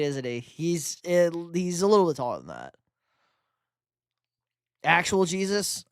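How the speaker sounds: ordinary talk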